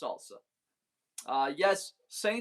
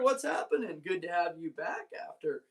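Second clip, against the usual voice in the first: ordinary talk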